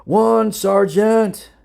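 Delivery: loud, drawn-out recruit's answer; shouted reply. A narrator's reading. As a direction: shout it back to the sergeant, high and slow. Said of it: "The one shouted back".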